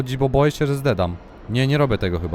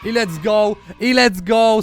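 high, louder celebratory cry; excited cheer; 5 semitones higher, 5 dB louder, slower than usual. Real, not acted. Gamer finds the wanted flashlight, excited cheer. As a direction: cheer a lucky find.

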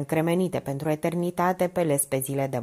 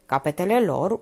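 usual speaking voice

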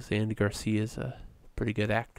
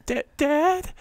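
much higher, pleading "Da-, Dad?"; emotional "Dad"; 4 dB louder than usual. Retold or imitated. Showing how a dying daughter should cry out to her dad, high and pleading. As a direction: show the feeling she failed to give the line. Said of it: "she doesn't get ev-, e-, give any emotion".

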